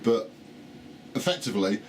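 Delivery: normal talking voice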